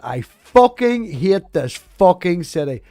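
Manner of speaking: loud, slow furious curse; angry outburst. Retold or imitated. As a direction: snap it out in road rage. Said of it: "And I just went".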